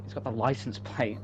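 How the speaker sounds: normal talking voice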